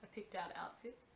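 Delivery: soft unvoiced whisper. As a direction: whisper the confession. Whispered confession.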